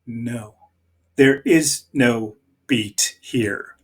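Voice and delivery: ordinary delivery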